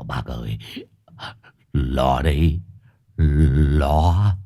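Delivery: low, trembling frightened voice; low shaky terrified man; terrified stammer. Acted. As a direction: stutter in panic. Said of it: "gật đầu run run".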